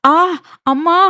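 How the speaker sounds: sudden high scared yelp; frightened cry. A narrator's reading. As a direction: shriek as the swing flies up. Swing flies, she cries out. (பயத்தில் அலறல்)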